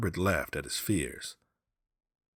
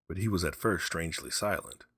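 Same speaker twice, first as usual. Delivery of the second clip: ordinary delivery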